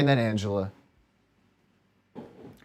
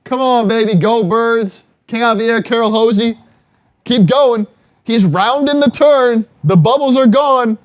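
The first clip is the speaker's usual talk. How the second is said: loud excited cheering, higher pitch; frat bro; 6 semitones higher, 5 dB louder than usual. Real, not acted. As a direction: shout him home like a race caller. Cheering the chugger on live.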